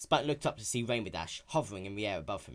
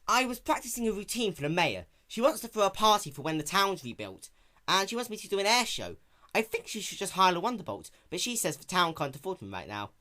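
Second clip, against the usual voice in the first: raised, brash tomboy pony voice; lightly raised young heroine; Rainbow Dash voice; 8 semitones higher, 4 dB louder than usual. Acted. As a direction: play her sporty and cocky.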